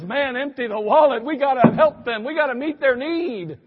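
acted fervent rallying cry; emotional appeal. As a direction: plead loudly like someone swept up by the cause. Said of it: "He's emotionally driven".

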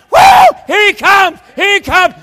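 very high, loud whoop and shout; excited whooping. Acted. The Father seeing you coming, excited whooping. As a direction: whoop with joy at the sight. Father runs to returning son.

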